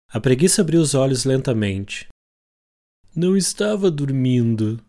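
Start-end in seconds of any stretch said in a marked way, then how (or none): 3.1-4.8 sleepy, hoarse and feeble; hoarse weak voice. Acted. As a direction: croak it out weakly, half asleep. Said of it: "disse com a voz rouca, fraquinha".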